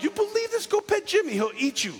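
high-pitched incredulous retort; incredulous outburst. Acted. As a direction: squeal it in mock disbelief. Mock outburst at a friend.

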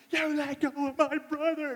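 high, loud imitation of a furious child; mock-furious kid shouting; mad little brother. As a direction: yell like a seven-year-old to the rescue. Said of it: "He is so mad".

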